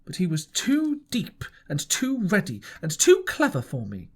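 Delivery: plain reading voice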